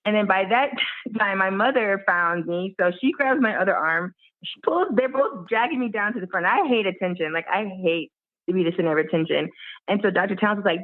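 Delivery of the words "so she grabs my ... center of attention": normal talking voice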